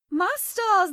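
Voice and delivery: a Southern belle gasping at a mess; surprised. Acted. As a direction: gasp out My stars in mock shock. Exclaiming at a mess.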